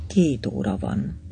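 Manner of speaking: a quiet, mechanical time call; quietly. A narrator's reading. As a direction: say the time in a low hush. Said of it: "és halkan így szólt".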